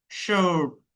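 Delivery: শার্প with a long held vowel; drawn-out vowel. Shown as a demonstration. Teacher explaining the colon after R, শার্প drawn out. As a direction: stretch out the middle of শার্প. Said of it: "আটা টেনে বলতে হবে".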